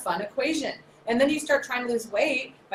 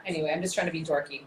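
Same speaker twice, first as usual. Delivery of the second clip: normal talking voice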